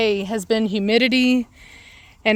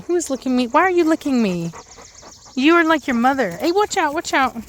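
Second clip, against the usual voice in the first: high playful dog talk; 5 semitones higher than usual. Real, not acted. Dogs licking her.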